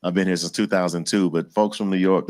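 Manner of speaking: normal talking voice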